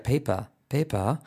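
"paper" with silent R, ending in an open "pa"; "paper" as "pei-pa" with British intonation. Shown as a demonstration. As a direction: drop the R of "paper", British-style. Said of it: "Una R británica sería".

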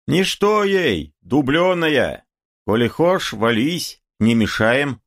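high-pitched taunting call. A narrator's reading. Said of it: "отступил за ларь и крикнул".

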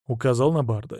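ordinary delivery

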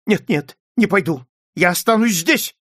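loud, high, agitated refusal; exclaiming. A narrator's reading. Stranger refuses to enter the study, exclaiming loudly. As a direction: refuse in rising alarm. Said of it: "воскликнул незнакомец".

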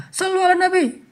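high imitated call for salawat; mock peacemaker's call. Bystander stopping a quarrel by asking for salawat, calling out. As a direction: cut in high over the bickering.

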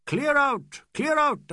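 high, loud furious shout. Acted. Aziz shouting the English away, loud fury. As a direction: hurl it out twice. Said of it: "Aziz shouting on rearing horse".

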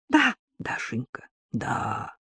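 soft hushed phone voice; whispering on the phone. Acted. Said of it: "Георгий продолжал шептать".

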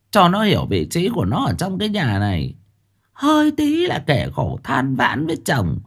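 calm down, still grumbling; mother softening toward her son, still complaining; softened tone. Acted. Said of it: "Bà Tháo dịu giọng".

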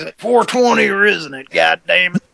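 high, loud impatient stoner. Pretend. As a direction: demand it like an antsy hippie.

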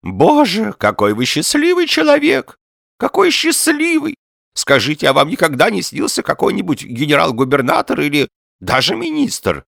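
put-on high, loud, eager old man; excited old man. A narrator's reading. Old man envies and questions Ostap.

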